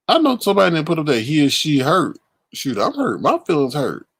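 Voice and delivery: ordinary talk, nothing marked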